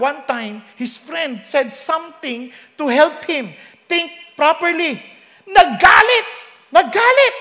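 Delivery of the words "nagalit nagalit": loud furious burst; angry shout. Retold or imitated. Preacher shouting how the king raged. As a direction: bellow the anger out. Angry outburst in retold story.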